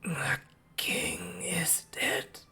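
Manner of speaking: weak, broken whisper, very slow; pained hoarse whisper. Acted. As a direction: barely whisper it, hopeless and hurting. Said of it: "very pained, hoarse, and weak voice".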